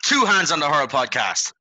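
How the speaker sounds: loud, high, slow chant; hyped podcast intro yell; shouted show catchphrase. Acted. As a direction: drag out the slogan like a crowd chant.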